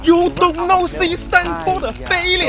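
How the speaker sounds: exaggerated Chinese accent, loud and high; shouting Chinese-accented parent voice. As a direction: bark it like an angry Chinese parent.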